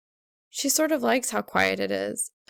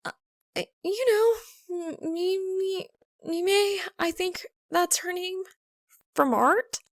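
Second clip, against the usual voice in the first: much higher, halting and nervous; flustered stammer; 10 semitones higher than usual. A narrator's reading. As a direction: stumble over the name.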